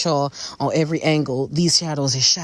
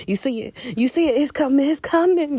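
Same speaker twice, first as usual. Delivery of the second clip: building excitement; 8 semitones higher than usual. Real, not acted. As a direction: build from hushed to thrilled. Building excitement over the reveal.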